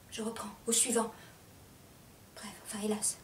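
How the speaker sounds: soft, broken restart; faint, halting murmur; hushed. Acted. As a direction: falter down into a whisper. Monologue breaks down.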